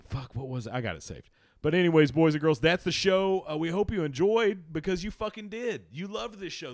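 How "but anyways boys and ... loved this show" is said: loud, high hype sign-off; loud show sign-off. Real, not acted. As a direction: wrap up the show with big energy. Sign-off to audience.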